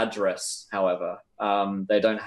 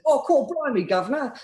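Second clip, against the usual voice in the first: high, hammed-up cockney; cockney vendor; 13 semitones higher than usual. Vendor greets the disguised player character, hammed-up cockney accent. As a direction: blurt it like a stage cockney. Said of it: "Uh, he says".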